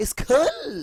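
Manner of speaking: "School" in a very high, loud, affected show-off voice; mocking show-off. Teacher mocking students showing off with the word. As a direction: ham up a posh, swaggering "school". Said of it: "ভাব নিয়ে বলবেন না".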